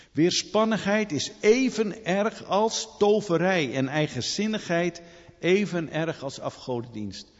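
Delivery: high, loud pulpit proclamation; preaching at full force. Acted. Preacher warning the congregation, loud and high. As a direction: ring it out like a prophet.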